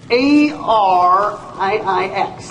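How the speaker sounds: slow, each letter spelled apart; deliberate slow spelling. Shown as a demonstration. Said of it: "spelling out the name".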